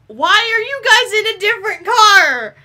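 high, loud wailing complaint; exasperated yell. Real, not acted. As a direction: yell at them in incredulous protest. Exasperated yelling at co-players.

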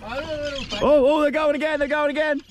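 ordinary talk, nothing marked

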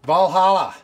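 hyped reveal of the sausage; excited announcement. Real, not acted. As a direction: proudly present the dish by name.

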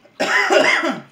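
harsh coughing fit; choking cough. Real, not acted. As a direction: cough hard, throat burning. Raw onion burning throat.

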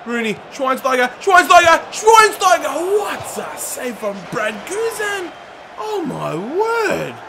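rising to a high loud yell; excited shouting. Real, not acted. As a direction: shout at the shot and save. Near-goal chance, save.

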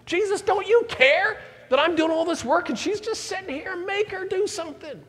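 high whiny Martha complaint; mimicking Martha. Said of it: "finally she goes in and says".

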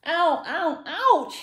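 high, loud repeated yelps; hammed-up storybook cries of pain; pained cry. A narrator's reading. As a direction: yelp with each stab of toothache. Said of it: "he cried".

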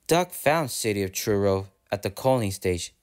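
usual speaking voice

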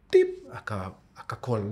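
ordinary talk, nothing marked

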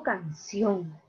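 "canción" slowly split, can-CIÓN; stress on last syllable. Shown as a demonstration. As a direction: draw out "canción", leaning on final CIÓN. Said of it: "aquí está la mayor fuerza de voz". ("canción" lento, marcando la última sílaba: can-CIÓN)

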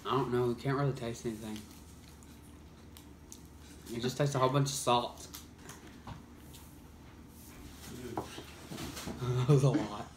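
speaking between bites; talking while chewing. Real, not acted. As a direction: mouth partly full. Seen 0: Bites apple just before, keeps chewing and talking with apple in hand.

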